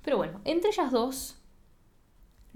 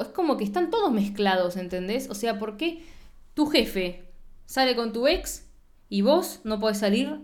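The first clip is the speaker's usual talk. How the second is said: ordinary talk, nothing marked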